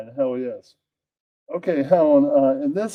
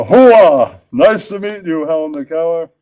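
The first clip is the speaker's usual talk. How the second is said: loud gung-ho hoo-ah voice; Al Pacino; 5 dB louder than usual. Acted. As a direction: boom it like Pacino. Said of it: "says Al Pacino".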